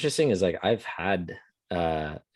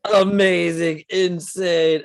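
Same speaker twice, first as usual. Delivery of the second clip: loud, high, drawn-out awe; hammed-up stoned amazement; blown away; 8 semitones higher, 5 dB louder, much slower than usual. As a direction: gush slowly as if tripping and amazed. Reliving acid-trip awe at book.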